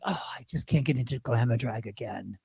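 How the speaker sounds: low, soft retold groaning complaint; weary reluctance. Performer recalling dread of glamour drag, low weary complaint. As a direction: sink low and quiet, mock-exhausted.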